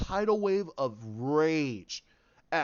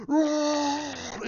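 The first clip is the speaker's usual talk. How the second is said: high, loud mock Chewie roar; hammed-up creature roar; imitated Wookiee roar; 8 semitones higher, 5 dB louder than usual. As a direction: belt out a Wookiee howl. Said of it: "Chewie goes".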